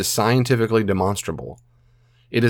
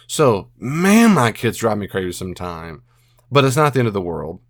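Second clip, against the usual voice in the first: usual speaking voice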